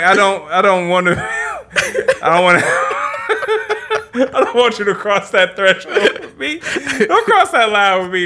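mock warning dissolving into laughter; laughing through it. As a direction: try to warn him off but keep bursting out laughing. Telling friend off, cracking up.

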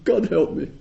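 a lighter, high echo of the plea; half-laughing repeat of the cry; desperate plea. Retold or imitated. Teacher repeating the sufferer's plea, lightly mocked. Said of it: "The person doesn't even believe in God".

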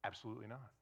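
ordinary talk

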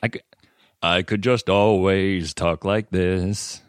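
lightly done slow creepy voice. Shown as a demonstration. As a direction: keep the creepy voice going, slowly. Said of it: "I'm gonna go back to normal voice".

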